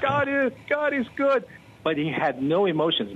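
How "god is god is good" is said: much higher, bursting with joy; excited praise. Retold or imitated. Pastor reacting on air to the caller's healing, excited and much higher. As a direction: exclaim it like you can't contain the thrill. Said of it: "And here I was".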